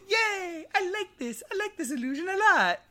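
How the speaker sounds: very high, squeaky-pitched happy character voice; Forager. Acted. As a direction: cheer in a tiny high put-on voice. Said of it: "Forager's like".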